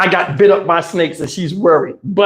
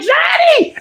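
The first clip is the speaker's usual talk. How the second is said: shrieked name. Retold or imitated. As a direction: scream his name as you fly. Aunt flung across car.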